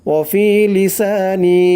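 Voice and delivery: ওয়াফি লিসানি drawn out slowly with each long vowel held a full beat; slow Quran recitation. Shown as a demonstration. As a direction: chant ওয়াফি লিসানি slowly in tajweed style. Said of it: "এক আলিফ টান দিতে হবে". (ধীরে তাজবীদসহ তেলাওয়াত)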